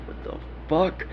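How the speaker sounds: breathy stunned whisper under his breath; shocked whisper. Retold or imitated. Alone by the fence at night, whispering in shock. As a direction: whisper it, too startled to speak up. Seeing a severed head at night.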